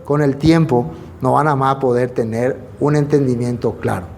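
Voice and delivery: ordinary talk, nothing marked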